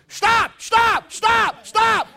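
high, loud mock cries of stop; hammed-up repeated shouts for mercy; acted-out yelling. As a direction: yell it like someone taking a beating. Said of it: "He kept yelling".

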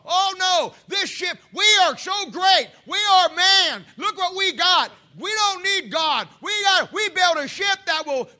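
loud, high, slow mock boasting; mocking boastful builders. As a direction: ham up the arrogant shipbuilders bragging. Preacher mocking proud shipbuilders.

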